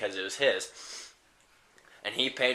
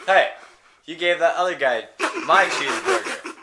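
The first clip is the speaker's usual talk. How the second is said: higher, louder mock complaint; indignant customer; 6 semitones higher, 7 dB louder than usual. Customer confronting clerk over his cheeseburger, mock indignant. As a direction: protest loudly with playful indignation.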